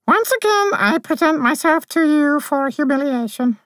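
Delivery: high, level, unhurried; deadpan fairy intoning her own humiliation; sleepy high drone of a captive fairy; dreamy monotone. Acted. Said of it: "dreamy monotone".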